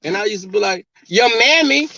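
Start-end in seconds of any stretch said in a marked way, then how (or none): 1.1-1.9 high, loud comeback; mock retort. Answering the ex's insult, high mock retort. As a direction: fling the insult back in play.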